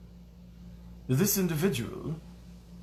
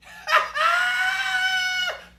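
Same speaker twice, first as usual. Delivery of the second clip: very high, long squealing laugh; shrieking cackle; 3 dB louder than usual. Pretend. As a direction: let out a piercing comic cackle. Cackle in the put-on voice.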